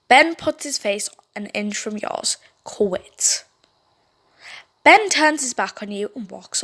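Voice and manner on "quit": whisper. Acted. Ben face an inch away.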